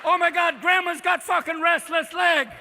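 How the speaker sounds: loud, high mock alarm; hammed-up shouted discovery; alarmed outcry. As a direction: cry out in comic shock. Onlooker alarmed at dancing grandma.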